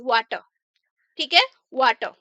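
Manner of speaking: वाटर said as "waa-tuh", the final r silent; r dropped. Shown as a demonstration. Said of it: "ये वाटर र नहीं".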